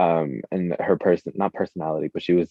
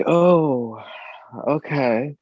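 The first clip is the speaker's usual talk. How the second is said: surprised oh okay; 9 semitones higher, much slower than usual. Retold or imitated. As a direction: play it stunned by the price. Reacting to thousand dollar price.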